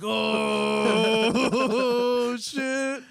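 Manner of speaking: long, drawn-out high shout; big imitated group "oh"; stunned crowd reaction. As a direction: holler it like a squad reacting to a roast. Squad's reaction to the roast.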